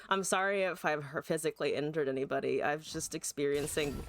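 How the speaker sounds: normal talking voice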